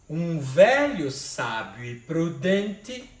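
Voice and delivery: drawn-out recited verse, syllable by syllable; slow poetic scansion. Shown as a demonstration. Teacher reciting the verse slowly for the class. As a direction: stretch each syllable like reciting a poem. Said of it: "a contagem é até a sílaba tônica".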